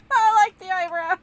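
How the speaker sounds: high, loud words through laughter; can barely get it out for laughing; laughing hard. Real, not acted.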